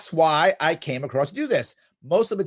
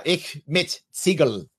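German saying; slower than usual. Retold or imitated. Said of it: "the saying they would say".

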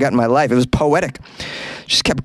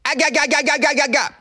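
stuck repeating; 11 semitones higher than usual. Real, not acted. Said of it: "I can't even get this out".

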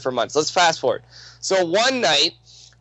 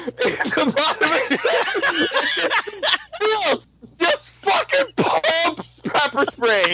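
high-pitched, laughing storytelling; cracking up, pitch soaring; 11 semitones higher than usual. Real, not acted. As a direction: struggle to talk through laughter. Laughing at the pepper spray.

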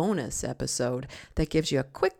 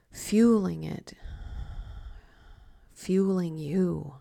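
slow, hushed meditation voice with long pauses; guided meditation. Acted. Coach leading listeners through grateful mealtime visualisation, slow guided meditation. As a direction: guide the listener gently, letting each phrase settle.

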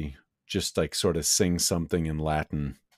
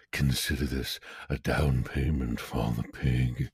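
lowered cold voice; 5 semitones lower than usual. Acted. Player voices his character, disdain.

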